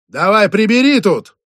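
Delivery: loud, high barked command; angry order. Acted. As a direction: order him to clean up now. Boss orders cleanup.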